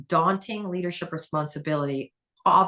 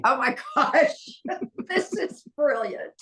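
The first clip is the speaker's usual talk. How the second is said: high, slow, laughing through it; laughing delight; 8 semitones higher, slower than usual. Real, not acted. Host recalling the book, laughing delight. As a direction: laugh while praising the idea.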